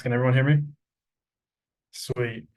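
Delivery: ordinary talk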